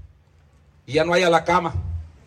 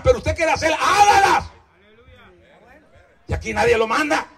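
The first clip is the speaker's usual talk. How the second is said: preaching at full force; 7 semitones higher than usual. Acted. Preacher daring the congregation to go ahead, shouting. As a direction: throw each dare out to the pews.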